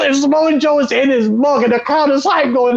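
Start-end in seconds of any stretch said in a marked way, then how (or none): none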